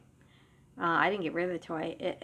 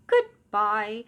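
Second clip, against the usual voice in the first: toy voice; 2 semitones higher, 6 dB louder than usual. Retold or imitated. Talking toy switching off.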